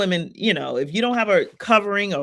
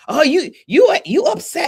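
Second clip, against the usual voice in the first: high, loud mock concern; exaggerated squeaky put-on voice; mocking mimicry; 12 semitones higher, 9 dB louder than usual. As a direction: imitate a clueless person, pitched way up. Mimicking someone who misunderstands her.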